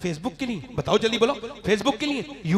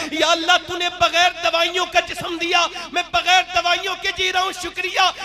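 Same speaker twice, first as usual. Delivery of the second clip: louder, calling out thanks to God; loud fervent prayer; 7 semitones higher, 5 dB louder than usual. Pretend. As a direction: proclaim the thanks with fervour.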